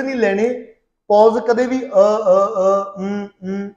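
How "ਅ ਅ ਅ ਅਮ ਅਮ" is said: hesitant drawn-out ums and uhs; filler pauses. Shown as a demonstration. Teacher showing students the pauses never to take, hesitant fillers. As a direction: demonstrate stalling filler sounds between pauses. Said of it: "ਇਹ ਪੌਜ਼ਸ ਕਦੇ ਨਹੀਂ ਲੈਣੇ".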